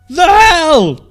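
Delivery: loud, high shocked yell; startled scream. Acted. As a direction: shout at the sudden chaos. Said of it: "the bald man screamed".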